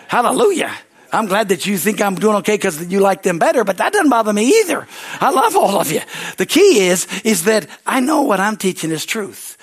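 high, loud fervent preaching. Acted. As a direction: shout it out to the church.

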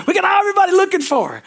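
high, loud excited telling; excited shout. Retold or imitated. Preacher retells the big police search, shouting high.

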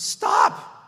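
high, loud exclaimed stop; mock yell. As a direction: cry out to stop him. Jesus halts Peter.

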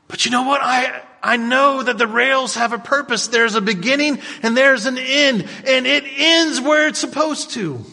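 high, loud, fervent preaching; preaching at full force. Acted. Pastor exhorting the congregation, high loud fervent preaching. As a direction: rouse the congregation to raise their hands.

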